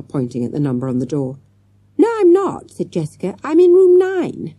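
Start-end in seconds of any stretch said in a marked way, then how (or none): 2.0-4.5 put-on high, loud protesting girl; little girl.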